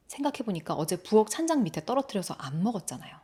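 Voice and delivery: ordinary delivery, nothing marked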